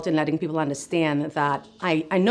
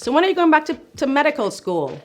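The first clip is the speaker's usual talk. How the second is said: high, louder curious patient voice; imitating a patient's question; 10 semitones higher, 5 dB louder, faster than usual. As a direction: pitch up, playing a curious patient asking. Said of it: "I often get asked from patients".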